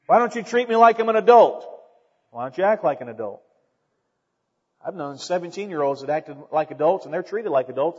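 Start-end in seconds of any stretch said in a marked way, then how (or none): 0.1-1.7 higher, louder mock protest; complaining youngster. Preacher mimics young people demanding adult treatment, complaining. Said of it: "Sometimes young people say". 2.4-3.4 lower, quick comeback; dry retort. Acted. Adult answers a young person demanding respect, dry retort.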